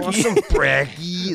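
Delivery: put-on American accent. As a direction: ask slowly in an exaggerated American accent. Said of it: "That's my American accent".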